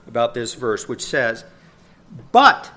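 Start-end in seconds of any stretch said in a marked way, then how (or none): 2.3-2.7 But jumped high and loud; stressed But opening the quote; emphatic but. Shown as a demonstration. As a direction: punch But hard and high. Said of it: "here's its emphatic I".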